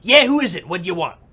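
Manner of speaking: annoyed New York shout; irritated bark. Acted. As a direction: snap at the caller. Producer answers phone irritated.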